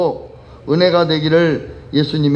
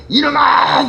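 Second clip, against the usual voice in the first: high mock shout of a cruel master; preacher acting a tyrant scolding his servant, high shout; tyrant yelling; 9 semitones higher, slower than usual.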